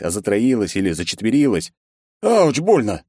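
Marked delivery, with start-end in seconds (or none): 2.2-3.0 high, loud cry of pain; pained yell. A narrator's reading. Boss yelling after the secretary hit him, pained. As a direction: yelp as if just struck. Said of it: "заорал я, хватаясь за лицо".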